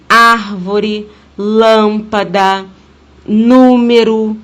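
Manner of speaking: "árvore, lâmpada, número", loud drawn-out first syllables; stress on antepenultimate syllable. Shown as a demonstration. Teacher giving proparoxytone examples, "árvore, lâmpada, número" loudly stressed on the first syllable. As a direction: punch ÁR, LÂM, NÚ slowly and loudly. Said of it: "é a antepenúltima".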